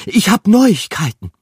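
high, loud excited rat voice; rat calling out. A narrator's reading. Rat bursting into the den, calling loud and high. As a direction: burst in shouting the news. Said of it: "rief sie".

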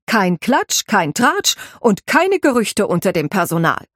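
a head housekeeper laying down the rules, firm and exacting; stern housekeeper. Housekeeper briefs the new maid on serving, stern and precise. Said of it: "Afras Blick wurde eisern und hart".

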